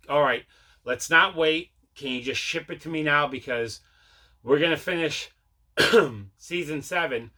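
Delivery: ordinary delivery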